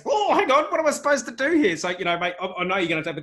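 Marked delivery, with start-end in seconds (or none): 0.1-1.8 much higher and louder, hammed-up confusion; squealing mock alarm at being lost; mock bewildered panic. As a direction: play a listener thrown completely off balance. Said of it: "I almost felt like".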